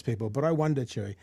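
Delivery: ordinary talk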